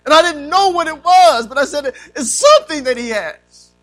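forceful preaching. Real, not acted. Preacher telling church of his search for God, forceful preaching.

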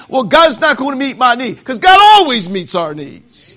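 loud, high and ringing; full-force preaching. Real, not acted. Preacher urging the congregation God meets needs. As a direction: lift the church with it.

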